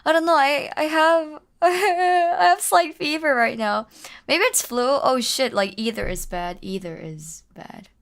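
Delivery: usual speaking voice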